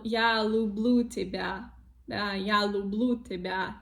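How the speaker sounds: люблю тебя with hard L, "loo-bloo teba"; hard consonants, wrong. Shown as a demonstration. Teacher imitating learners' mistake, hard L in люблю. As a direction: say люблю as "looblu", nothing softened. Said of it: "usually pronounce hard sounds".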